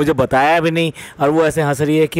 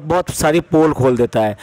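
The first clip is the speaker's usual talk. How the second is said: normal talking voice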